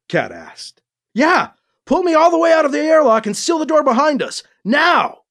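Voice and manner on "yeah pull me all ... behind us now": high-pitched, fast urgent shouting; acted shout. A narrator's reading. Hero to cat man at the airlock, urgent acted shout. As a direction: shout orders in a rush. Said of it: "Alibi shouted".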